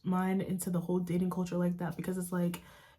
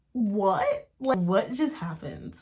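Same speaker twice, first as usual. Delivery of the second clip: louder, drawn-out retold shock; shocked disbelief; 5 dB louder, much slower than usual. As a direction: replay being stunned by sudden news. Reenacts shock at being dumped.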